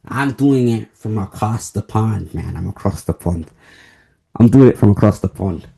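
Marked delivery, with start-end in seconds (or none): 2.6-5.7 put-on light British accent, a touch quicker. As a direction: put on a British voice for the pond line. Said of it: "You see how I'm in the British accent?".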